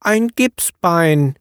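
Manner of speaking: put-on high, slow child's answer; little boy. A narrator's reading. Norbert answering the painter, slow high child voice. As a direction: answer simply like a small boy. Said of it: "erwiderte der Kleine".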